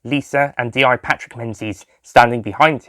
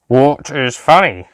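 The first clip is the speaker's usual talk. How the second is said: very slow, flat robotic drone; robotic Cyberman voice; 3 semitones higher, 4 dB louder, slower than usual. A narrator's reading. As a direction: speak like a cold machine, word by word. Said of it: "the cyber lieutenant asked".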